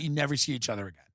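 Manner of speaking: usual speaking voice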